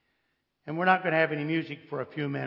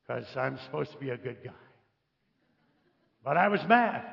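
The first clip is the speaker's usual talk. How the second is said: normal talking voice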